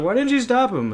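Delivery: high-pitched mock bystander outcry; half-yelled, high retold question. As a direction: imitate an upset onlooker calling out. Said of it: "people would yell at us".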